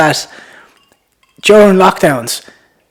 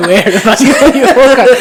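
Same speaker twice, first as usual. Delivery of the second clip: laughing; 7 semitones higher, 4 dB louder than usual. Acted. Mocking Ballymun pronunciation, laughing.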